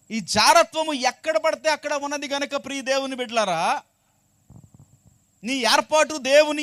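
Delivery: high, loud exhortation; full-force preaching. Real, not acted. Preacher urges God's children to answer, full-force preaching. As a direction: call out to the congregation and demand an answer.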